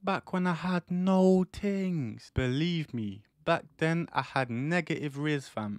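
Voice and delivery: ordinary talk, nothing marked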